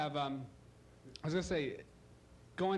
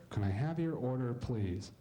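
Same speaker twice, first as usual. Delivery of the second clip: low, tinny voice through a speaker; muffled drive-thru girl; 6 semitones lower than usual. Retold or imitated. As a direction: sound like a bored voice through a drive-thru box. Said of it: "She sounds like this in real life".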